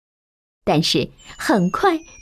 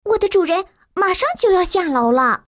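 higher, fast urging servant; hurried maid; 9 semitones higher, faster than usual. A narrator's reading. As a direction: rush the boy into hiding. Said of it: "佣人把杰克推进炉子里". (急促催促的女佣)

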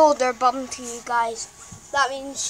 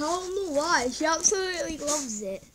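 normal talking voice

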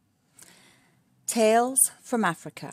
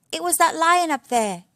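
high, loud little piglet voice; a child pointing out the real culprit; Baby Warthog; 9 semitones higher, 7 dB louder than usual. Acted. Baby Warthog blaming the lion to his parents, high and loud.

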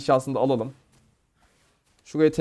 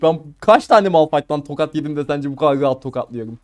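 normal talking voice

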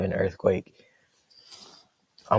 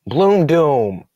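excited callout; 5 semitones higher, 7 dB louder than usual. Real, not acted. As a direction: hype the move name louder and higher. Calling out his attack.

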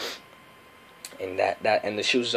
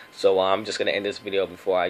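ordinary delivery

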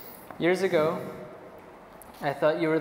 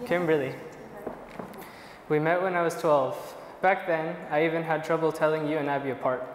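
usual speaking voice

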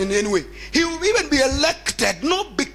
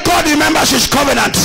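louder, emphatic repeat; preached repeat; 2 semitones higher, 7 dB louder than usual. Shown as a demonstration. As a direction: proclaim it to the congregation. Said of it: "I say".

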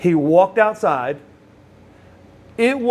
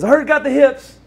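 high and loud, imitating an excited friend; acted-out delighted exclamation, pitched way up; eager greeting; 11 semitones higher, 6 dB louder than usual. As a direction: call it out with rising excitement.

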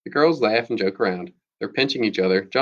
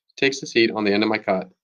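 ordinary delivery

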